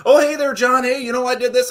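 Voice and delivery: high-pitched, breezy mock greeting; imitated over-friendly office hello; chummy employee greeting. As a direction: pitch it up like a chirpy guy greeting his boss. Voicing an employee greeting executive.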